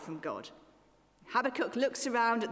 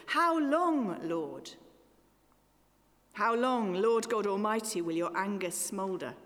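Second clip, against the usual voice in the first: psalm lament reading. Acted. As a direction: lament read from the pulpit.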